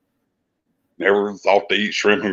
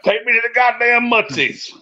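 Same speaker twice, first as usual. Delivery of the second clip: much higher, put-on whiny drunk; drunken demand; 12 semitones higher than usual. Drunk asking bandmates for late-night grits, high whiny plea. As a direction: beg the friends like a drunk kid. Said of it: "I'd be like".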